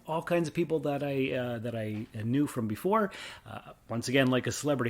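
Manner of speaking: usual speaking voice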